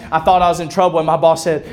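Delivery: ordinary talk, nothing marked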